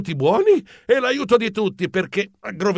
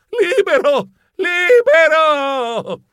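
much higher, loud, slow drawn-out shout; joyful cry; 10 semitones higher, 4 dB louder, much slower than usual. Acted. Geronimo freed from the net, exultant shout. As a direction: cry out in triumph.

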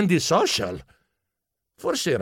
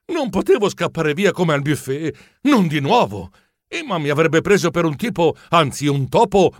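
usual speaking voice

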